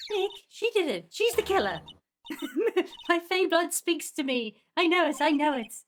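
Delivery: put-on high, loud, excited girl sidekick; excitable accuser. Maya accusing Vasquez to Nick, high excited insistence. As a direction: pitch it up and insist.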